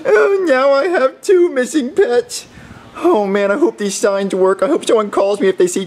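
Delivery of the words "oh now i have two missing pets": high, hammed-up bawling; crying out. Boy now has two missing pets, crying out. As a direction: cry out, then wail miserably.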